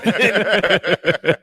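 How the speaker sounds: high, loud burst of laughing; loud real laughter. Real, not acted. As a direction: crack up at your own anecdote. Laughing at own anecdote.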